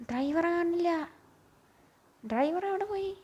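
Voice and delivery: sudden alarm at the empty seat; surprised. Real, not acted. Driver missing from the bus. (അമ്പരപ്പോടെ ഉയർന്ന ശബ്ദത്തിൽ)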